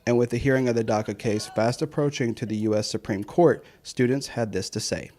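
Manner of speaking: steady anchor-style report; news read. Acted. Voice-over of news story.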